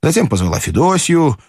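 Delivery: ordinary delivery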